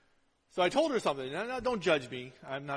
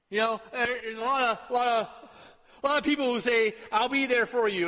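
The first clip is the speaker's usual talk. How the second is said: loud, higher-pitched pulpit delivery; raised, urgent sermon voice; forceful preaching; 6 semitones higher, 9 dB louder than usual. Acted. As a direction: drive the point home to the congregation. Preaches on being left alone.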